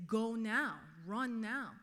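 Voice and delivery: loud, firm order to move right now; retold urgent order shouted down the phone; authoritative command. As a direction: command her to leave, no room for argument. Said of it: "in a very authoritative voice".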